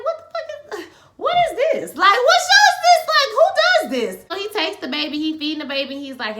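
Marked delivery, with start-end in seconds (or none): 0.1-4.2 very high, loud comic squawk; hammed-up, high-pitched bewildered outburst; incredulous outrage. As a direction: squeal it in exaggerated disbelief. Acting out her baffled outrage at show.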